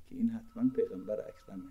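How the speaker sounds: fast hushed whisper; whispered aside. Real, not acted. Preacher confiding to the seminary students, fast whisper. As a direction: lean in and whisper it quickly.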